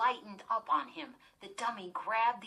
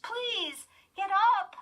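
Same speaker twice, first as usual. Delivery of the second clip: pleading; 11 semitones higher, 7 dB louder than usual. A narrator's reading. Lindy begging her sister.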